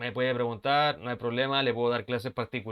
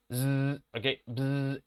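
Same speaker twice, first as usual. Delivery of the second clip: a voiced zz buzz like a buzzer; buzzing sound; much slower than usual. Shown as a demonstration. Teacher imitating the buzzer for students. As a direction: buzz through the teeth. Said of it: "ese sonido es un buzz".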